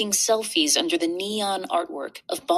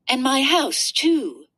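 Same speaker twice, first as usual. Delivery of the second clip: princess voice; 5 semitones higher, 4 dB louder, slower than usual. Acted. Princess adds her house.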